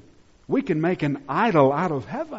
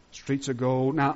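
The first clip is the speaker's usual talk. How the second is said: ordinary talk, nothing marked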